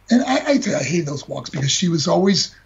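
ordinary delivery

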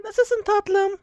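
high falsetto woman; man doing a woman's voice. Pretend. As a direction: purr it like a flirty woman. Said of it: "Kadın sesi yapacaktım".

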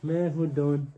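normal talking voice

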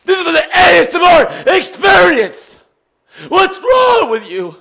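loud, high angry yelling; furious shouting. Real, not acted. Streamer yelling at his mod to ban a troll, furious shouting. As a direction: bark orders at the mod.

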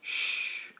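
loud whispered shush. Acted. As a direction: hush the chick with a sharp shh. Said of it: "the mother says".

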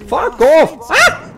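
loud, high-pitched lashing out; angry yelp. Real, not acted. Player fending off an attacker, angry yelp. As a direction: shout it off, ending in a high yelp.

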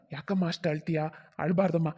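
low, soft fatherly soothing; gentle consoling. Acted. Father comforting crying bride, gentle and low. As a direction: hush her tenderly, voice dropped.

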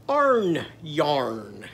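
"Arn yarn" in two long syllables; vowels swapped to "ar". Shown as a demonstration. Teacher shows onion with Arnie's vowel, spaced "Arn yarn". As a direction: stretch both "ar" sounds in "Arn yarn". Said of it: "it's gonna be like this".

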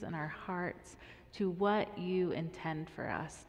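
soft, earnest prayer voice. Acted. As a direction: pray gently over the children. Leads a prayer.

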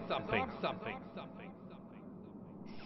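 repeats fading to nothing; fading echo. Shown as a demonstration. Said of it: "an echo is when that sound bounces off something".

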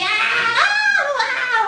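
high, loud, drawn-out squawk; hammed-up bird squeal; bird cry. Shown as a demonstration. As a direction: call out as a puppet bird. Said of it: "Bird and say".

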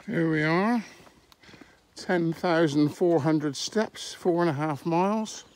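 usual speaking voice

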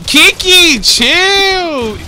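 high, loud drawn-out yell; loud, high-pitched plea to stop; exasperated shout. Real, not acted. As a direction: shout at a player to back off. Yelling at player to stop.

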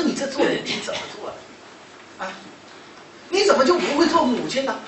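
high, pressing rhetorical questions; reproachful scolding. Retold or imitated. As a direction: take a mother to task. Retold scolding of the mother.

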